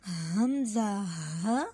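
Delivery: हमज़ा ह very slow, from the deep throat; throat letter demo. Shown as a demonstration. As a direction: let हमज़ा ह come slowly from the back of the throat. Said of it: "आवाज़ थोड़ी ऐसे आए".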